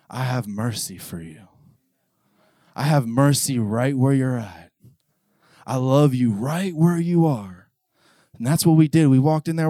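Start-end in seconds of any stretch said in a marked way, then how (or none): 0.1-7.7 tender voice of God. Acted.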